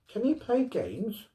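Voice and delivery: put-on high, eager child's question; a girl curious about her puppy; little girl voice. A narrator's reading.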